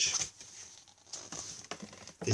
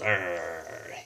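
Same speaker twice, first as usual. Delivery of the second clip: puppy growl; 5 semitones lower, 5 dB quieter than usual. Acted.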